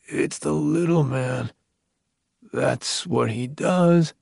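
slow, grave and measured man; sober voice. A narrator's reading. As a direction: say it soberly and slowly. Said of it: "Evan's voice was sober".

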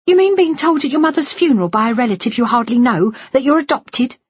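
high-pitched, indignant reproach; hurt daughter confronting her father; bitterly incredulous daughter. Acted. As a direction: spell out the outrage, pitched well up.

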